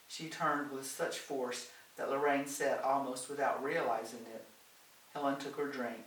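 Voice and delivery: ordinary delivery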